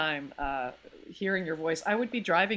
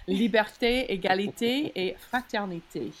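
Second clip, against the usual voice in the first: put-on measured French-accented proclamation; 2 semitones higher than usual. Declaims French motto for the trio.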